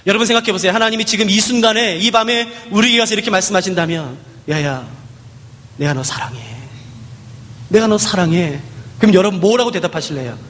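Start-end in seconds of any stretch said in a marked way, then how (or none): 4.5-8.6 lower, soft, loving words; tender voice of God. Pretend. God tells the congregation he loves them, low and tender. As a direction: speak love tenderly, like a father. Voicing God's love.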